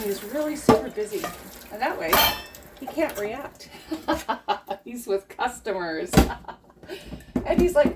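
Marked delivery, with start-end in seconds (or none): none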